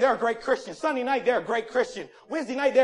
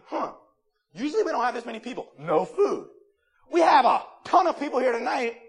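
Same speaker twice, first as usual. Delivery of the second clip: ordinary talk, nothing marked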